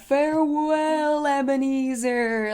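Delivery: slow, drawn-out ghostly farewell. Retold or imitated. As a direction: stretch it out like a spooky ghost. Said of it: "like, ghost voice".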